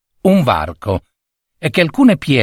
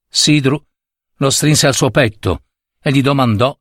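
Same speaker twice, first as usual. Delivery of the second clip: usual speaking voice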